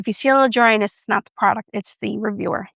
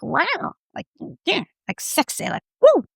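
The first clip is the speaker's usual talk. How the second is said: breathy-free playful coos, swooping high on wow and woo; mock sexy exclamations; 8 semitones higher than usual. Vlogger hyping her sexy lipstick shade, playful mock coos. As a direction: tease each word like a flirty ad.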